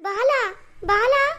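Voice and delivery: high, urgent call for her son. Acted. Mother calls out for her son.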